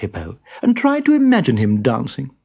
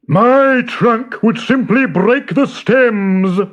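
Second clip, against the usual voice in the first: high, slow, louder elephant excuse; 8 semitones higher, 4 dB louder, slower than usual. A narrator's reading.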